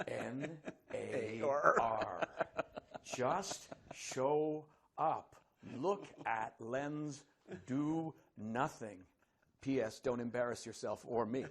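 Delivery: very slow, each word set apart; slow, spelled-out reading. Retold or imitated. The actor reads Hopkins's acting note to his friend, slowly. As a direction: read the note out deliberately.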